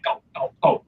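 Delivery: whispered hurried go go go; urgent whisper. Retold or imitated. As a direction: hiss them onward under your breath. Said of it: "I'm just like".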